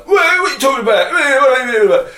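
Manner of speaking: high, loud and fast mock confusion; baffled bloke. Straight lad confronted in the changing room, fast high mock shout. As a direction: blurt it out fast and high, playing dumb. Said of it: "'cause they're like".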